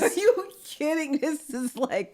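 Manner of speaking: high-pitched, laughing incredulity; real incredulous amusement; amused disbelief. Real, not acted. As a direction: laugh in disbelief, dragging out the last word. Reacting to sister's silly glasses story.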